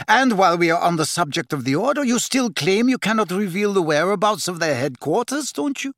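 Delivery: high, rapid-fire accusation; heated questioning that climbs at the end; snarling. A narrator's reading. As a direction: press the attack angrily. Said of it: "snarled Bellatrix".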